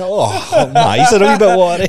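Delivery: high, fast and loud, a laughing mock excuse; fake casual excuse. Hiding tears from the lads on the stag plane, a laughing mock-casual excuse. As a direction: hide the tears with a breezy fib, laughing. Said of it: "just pretending".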